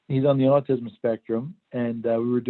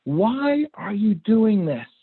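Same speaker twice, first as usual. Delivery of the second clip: mock yell, higher and slow; retold yell; 9 semitones higher, much slower than usual. Frustrated dad yelling at his son over homework, mock yell. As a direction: stretch out an exasperated shout. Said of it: "Finally, I yelled at him".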